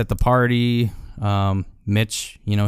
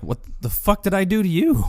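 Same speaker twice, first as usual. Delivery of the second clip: high-pitched, bewildered mock outrage; imitated indignant protest; 9 semitones higher than usual. Insulted cook asks what he did, high indignant imitation.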